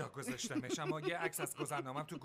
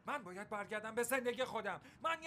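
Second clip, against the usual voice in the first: angry outburst; 8 semitones higher, 3 dB louder than usual. Acted. Brothers quarrelling, S0 lashes out.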